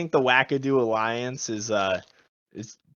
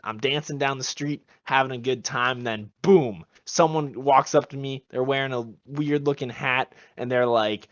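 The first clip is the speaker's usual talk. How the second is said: normal talking voice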